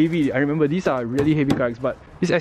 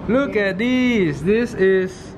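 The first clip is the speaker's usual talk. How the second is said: excited; 8 semitones higher than usual. Real, not acted. Excited discovery in shop.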